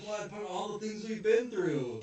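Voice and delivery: high, loud theatrical disbelief; mock wounded protest. Onizuka begs the friend who refused him, mock hurt. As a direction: plead like a hammed-up betrayed friend.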